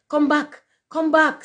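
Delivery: very high, loud call; screamed. A narrator's reading. Rocket calling the departing duck, screaming. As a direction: scream after someone leaving. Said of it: "screamed the rocket".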